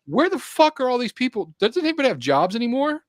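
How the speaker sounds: high-pitched comic outrage; incredulous, worked-up mock complaint; exasperated rant. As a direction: act out baffled irritation at the crowds. Acted rant at weekday crowds.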